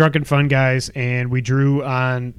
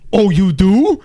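louder, higher mock New Yorker; drifting New York accent; 4 semitones higher, 5 dB louder, slower than usual. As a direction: react in a wobbly, put-on New York accent. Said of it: "accent is changing drastically throughout the story".

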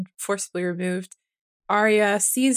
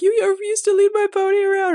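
put-on much higher, louder little-girl voice; young girl's plea; 12 semitones higher, 6 dB louder than usual. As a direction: play the girl reminding him, high and urgent.

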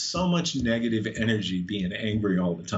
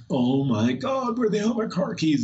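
mildly panicked, pitch climbing high; mock freak-out; 8 semitones higher than usual. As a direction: act out losing it over something missing. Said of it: "you're freaking out".

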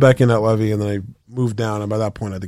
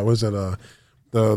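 ordinary delivery

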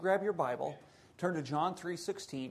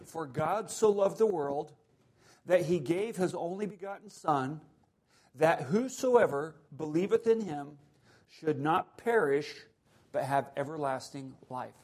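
scripture reading; 3 dB louder than usual. Acted. Preacher reading John three sixteen to the church. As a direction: read the verse with solemn weight.

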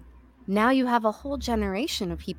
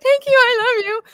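high, loud, breathless thanks; gushing fan; 8 dB louder than usual. Retold or imitated. Fan gushing to Carrie Fisher as she walks away, high and loud. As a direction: gush like a starstruck fan.

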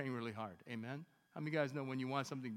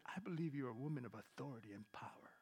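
intimate whisper; hushed whisper of encouragement; whispered prophecy; 2 semitones lower, 5 dB quieter than usual. Retold or imitated. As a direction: breathe it close to her.

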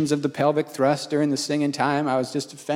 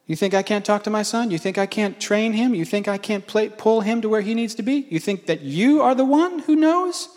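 higher, mounting rhetorical questions; indignant rebuke; 6 semitones higher than usual. Retold or imitated. Acting out God's indignant rebuke.

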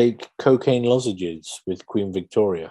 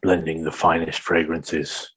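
sultry perfume ad; 6 semitones lower than usual. Acted. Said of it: "in the style of a perfume advert".

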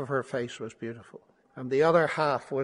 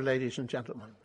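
usual speaking voice

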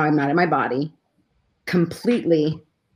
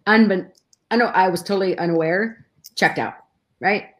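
ordinary talk

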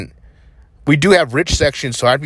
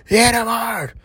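higher, urgent mock old lady; old lady's demand; 10 semitones higher, slower than usual. Old lady urging him to hit her dog, higher mock voice. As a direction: imitate the lady egging him on. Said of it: "And then she's like".

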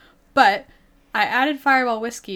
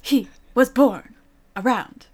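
William Shatner; 2 semitones higher, 3 dB louder than usual. Pretend. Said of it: "Not William Shatner".

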